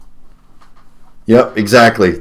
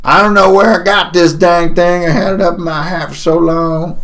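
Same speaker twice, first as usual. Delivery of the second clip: high, hollering prospector gripe; high-pitched old-timer voice; crazy old prospector; 9 semitones higher than usual. Acted. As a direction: complain like a baffled old miner. Prospector puzzles over his hairpin.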